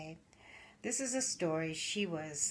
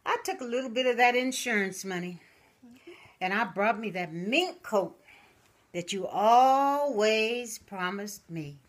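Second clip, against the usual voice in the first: put-on old widow to urn; 4 semitones higher, 8 dB louder than usual. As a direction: croon it to her late husband's urn. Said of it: "And she said".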